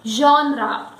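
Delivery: GENRE as ZHON-ra, falling; correct pronunciation. Shown as a demonstration. Teacher summing up the correct pronunciation. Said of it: "the pronunciation becomes genre".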